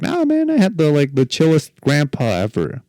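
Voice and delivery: very high, squeaky casual protest; high squeaky reply. Retold or imitated. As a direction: squeak it out, laid-back. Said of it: "I was like".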